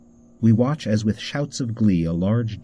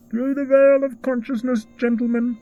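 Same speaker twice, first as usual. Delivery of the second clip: very high, eerie tinny voice; muffled tin voice; 3 dB louder than usual. Acted. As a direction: speak like a hollow mechanical mask. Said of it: "A muffled voice of tin speaks out".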